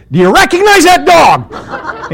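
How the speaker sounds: high, slow, loud question; re-enacted frustrated holler; exasperated shout. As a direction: yell each word at him. Yelling question at pickup driver.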